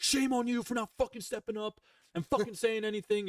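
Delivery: high angry yell at a friend; screaming at him. Retold or imitated. Shouting at a friend who stayed silent, angry yell. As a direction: yell it, blaming him for not stepping in. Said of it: "like, screaming at him".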